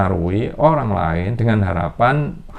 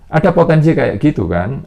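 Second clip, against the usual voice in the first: usual speaking voice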